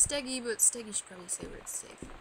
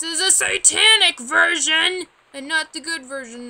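alarmed; 5 semitones higher, 16 dB louder than usual. Real, not acted. Alarmed at satanic symbol.

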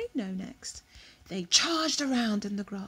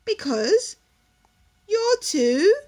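higher, louder sneer; scornful; 9 semitones higher, 12 dB louder, slower than usual. Acted. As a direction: spit it out with disdain. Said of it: "said the peacock scornfully".

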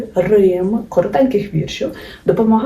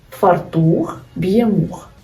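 very slow, each word spaced out; slow mnemonic; much slower than usual. Shown as a demonstration. Teacher to students, reciting the фартух rhyme very slowly. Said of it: "Або ж".

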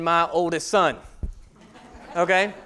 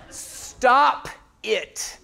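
laughing retelling; 3 semitones higher than usual. Retold or imitated. Pastor retelling wife's warning to congregation, laughing. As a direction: stop it said through a laugh. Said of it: "silently yells".

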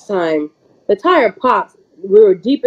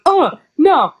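high disgusted squeal; handed a chunk of fur, disgusted squeal; 10 semitones higher than usual. Retold or imitated. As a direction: recoil from the fur.

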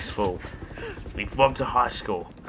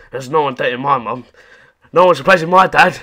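mock angry Miles; 3 semitones higher, 9 dB louder than usual. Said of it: "Miles' fist just starts charging up".

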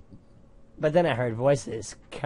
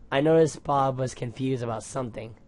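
usual speaking voice

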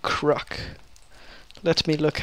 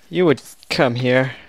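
loud; 10 dB louder than usual. Real, not acted. A mob approaches him.

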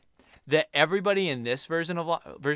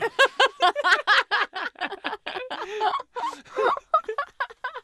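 high burst of laughter; 6 dB louder than usual. Real, not acted. Laughing at own accent.

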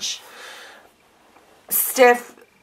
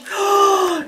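high shouted gasp of delight; sharp gasp; 11 semitones higher than usual. Real, not acted. Seeing the album close up, a shouted gasp. Overwhelmed opening gift album.

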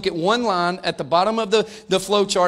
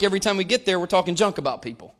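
ordinary talk

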